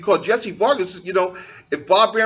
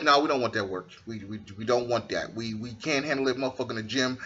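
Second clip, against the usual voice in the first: muttered scheming; 10 semitones lower, 11 dB quieter than usual. Retold or imitated. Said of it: "then was like".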